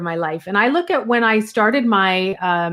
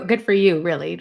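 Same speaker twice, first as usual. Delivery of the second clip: low-key, genuine praise at normal pitch; mean it, dropping lower on "really"; sincere, settling down gently at the end; sincere congratulations; 3 semitones lower than usual. Real, not acted. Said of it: "I'm being sarcastic about them".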